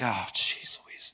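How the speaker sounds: groggy whisper, slow and put-upon; sleepy exasperation. Pretend. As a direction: whisper it as if woken at night. God woken by late phone call.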